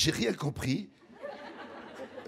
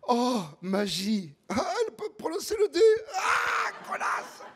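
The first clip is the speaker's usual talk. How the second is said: excited outburst; 11 semitones higher than usual. Acted.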